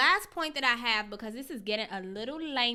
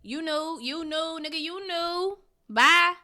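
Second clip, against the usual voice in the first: mock dismissal; 3 semitones higher, 7 dB louder than usual. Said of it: "it's like".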